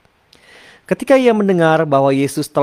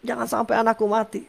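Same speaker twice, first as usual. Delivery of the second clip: high strained begging, fading toward the end; plead, voice climbing, then sinking; desperate plea; 10 semitones higher than usual. Retold or imitated. Official pleads with Jesus to come before his son dies, high and desperate. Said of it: "ada satu perkataannya".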